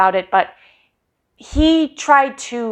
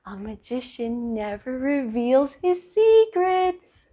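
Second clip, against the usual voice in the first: sly wink; 6 dB quieter, slower than usual. Retold or imitated. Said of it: "smile and wink and be like".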